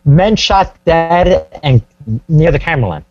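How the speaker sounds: usual speaking voice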